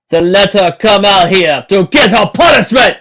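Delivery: loud, high, slow proclamation; stern captain. A narrator's reading. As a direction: lay down the law to the crowd. Captain shouts.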